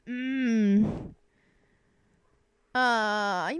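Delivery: ordinary delivery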